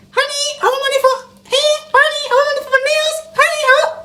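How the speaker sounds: put-on high, fast pleading girlfriend voice. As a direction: squeak it out like a needy girlfriend. Mimics demanding girlfriend.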